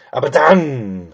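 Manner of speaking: slow, weighty, darkly dramatic "Aber dann"; "Aber dann" drawn out with mock doom. Real, not acted. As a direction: say "Aber dann" like an ominous warning. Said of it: "it sounds dramatic".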